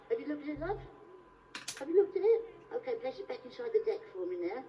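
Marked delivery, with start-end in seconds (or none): 1.8-2.4 high, loud, impatient check; insistent repeat. Acted. Performer chivvying his volunteer, loud and insistent. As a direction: raise it sharply to get an answer. Repeats question after no answer.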